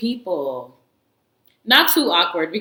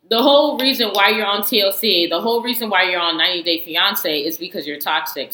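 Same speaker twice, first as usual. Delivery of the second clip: normal talking voice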